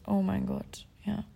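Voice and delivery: breathy whisper of embarrassment; whispered groan. Real, not acted. Recalling the embarrassing night, mortified whisper.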